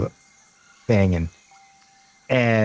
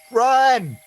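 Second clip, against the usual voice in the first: high, held cry; woman's voice ringing out; 14 semitones higher than usual. Acted. Game master voices a woman's warning, ringing out. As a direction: call out one long, high warning. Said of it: "You hear the woman's voice ring out".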